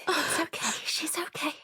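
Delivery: soft hushed whisper; whispered reassurance. Acted. Comforting mum over sleeping sister, whispered. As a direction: keep it under your breath.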